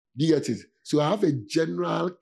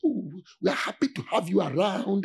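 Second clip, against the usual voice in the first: sweet welcoming voice; 5 semitones higher than usual. Retold or imitated. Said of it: "my voice is not sweet".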